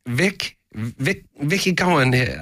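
put-on high, hammy British accent; a cheesy high British greeting. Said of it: "a mean British accent".

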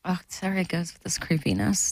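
hushed and creepy. Acted. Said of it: "That's your creepy voice".

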